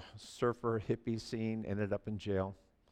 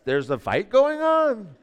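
ordinary talk

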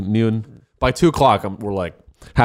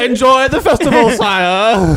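much higher, louder mock-courtly send-off; bow him out like a fair servant; courtly fair worker; 11 semitones higher, 5 dB louder, slower than usual. Fair worker sends the customer off, high hammed-up courtly voice.

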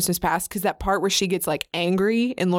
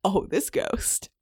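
unimpressed; 3 semitones lower, slower than usual. Retold or imitated. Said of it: "Guy Pearce would be like".

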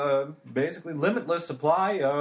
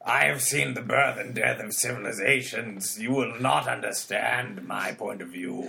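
gruff ancient being. Acted. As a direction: speak like something eternal and weary. [name] keeps ancient creature voice.

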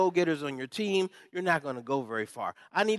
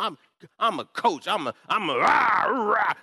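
rah-rah coach; 4 semitones higher, 6 dB louder, slower than usual. Real, not acted. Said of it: "I ain't softening no tone".

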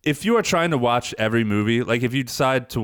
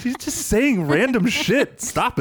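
laughing complaint; 12 semitones higher, slower than usual. Real, not acted.

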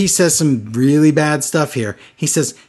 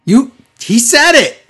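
higher and louder, a stunned gasp then slow disbelief; shocked podcaster to his co-host, loud and high; real shock; 8 semitones higher, 7 dB louder, much slower than usual. Real, not acted. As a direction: react as if the line crossed a line. Shocked by insult in the show.

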